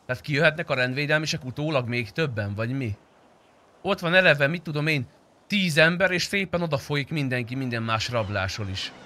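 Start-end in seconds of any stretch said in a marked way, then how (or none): none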